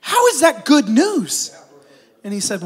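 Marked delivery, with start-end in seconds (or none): 0.0-1.6 What? How is that good news? pitched up and slowed, half laughing; mock outrage from the doomed patient; shocked disbelief. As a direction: play the patient's stunned disbelief for the joke. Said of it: "The guy goes".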